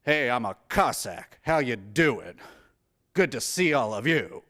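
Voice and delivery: low, casual American greeting; Telly Savalas. Retold or imitated. Cossack walks in greeting everyone, low and casually American. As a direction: stroll in as an unconvincing Cossack, pitched low. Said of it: "Telly Savalas playing a Cossack who just walks in like".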